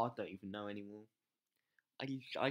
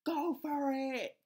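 high, loud, slow cheer; stretched-out rallying cry; 13 semitones higher, 9 dB louder, much slower than usual. Real, not acted. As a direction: urge your troops on at full voice. Cheering troops on.

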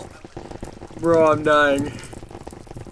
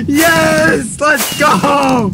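high, loud celebrating yell; victory shout; 11 semitones higher, 3 dB louder, much slower than usual. Real, not acted. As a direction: cheer the win. Wins the fight, celebrating.

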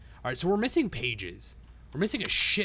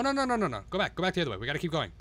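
ordinary delivery

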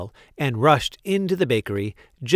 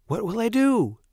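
distressed cry; 10 semitones higher than usual. A narrator's reading. Baker has spilled all the milk, distressed cry. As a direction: cry out in dismay. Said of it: "cried Walter".

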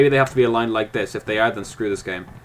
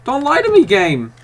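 high, loud outrage at the game; frustrated shout; 12 semitones higher, 8 dB louder than usual. Real, not acted. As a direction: yell at the game for cheating. Arguing with the puzzle game.

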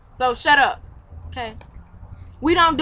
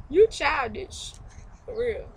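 ordinary talk, nothing marked

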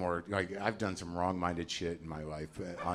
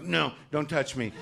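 sulking; 6 semitones higher than usual. Retold or imitated. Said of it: "I'm like".

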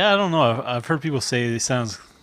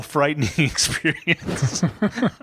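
breaking into a laugh mid-word, slower; 3 semitones higher than usual. Real, not acted. Reading Wozniak's Tesla quote to co-hosts, laughing. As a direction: read it through real laughter.

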